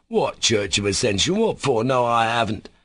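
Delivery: ordinary delivery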